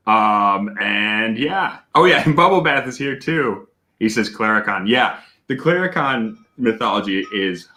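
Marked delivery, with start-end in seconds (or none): none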